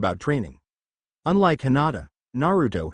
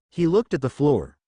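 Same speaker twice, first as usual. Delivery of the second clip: ordinary delivery, nothing marked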